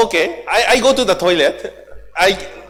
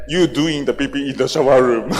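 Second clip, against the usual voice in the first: lightly voiced, lower father figure; imitating Father; 6 semitones lower than usual. Father's accusation retold before Japanese workshop audience, imitated low. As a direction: mimic the father with pitch dropped. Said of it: "the father talked like this and saying".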